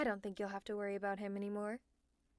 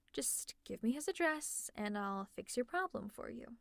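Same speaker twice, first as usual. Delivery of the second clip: ordinary talk, nothing marked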